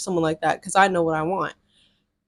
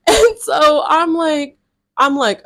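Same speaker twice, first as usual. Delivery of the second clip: cracking up, high, loud and slow; can barely get the words out through the laughter; laughing hard; 6 semitones higher, 6 dB louder, slower than usual. Real, not acted. Laughing while retelling his reply.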